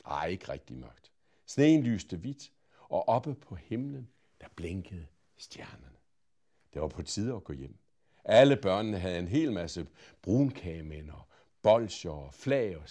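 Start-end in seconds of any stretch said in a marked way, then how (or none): none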